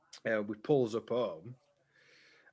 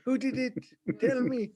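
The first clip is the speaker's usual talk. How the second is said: in his voice; 13 semitones higher than usual. Retold or imitated. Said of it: "Says he did in his voice".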